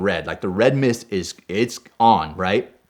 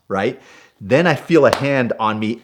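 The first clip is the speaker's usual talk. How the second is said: usual speaking voice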